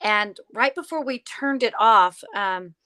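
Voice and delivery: ordinary delivery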